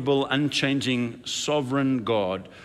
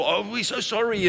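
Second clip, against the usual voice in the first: mock contrite apology; 9 semitones higher than usual. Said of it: "they were all".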